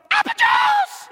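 put-on very high, loud child's yell; girl yelling for apple juice. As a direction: scream for the juice like an excited girl. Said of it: "but she yelled".

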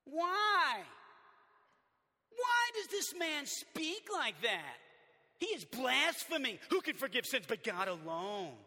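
high, loud, slow outraged questioning; scandalized religious men objecting; indignant scribes. Acted. Scribes questioning Jesus inwardly.

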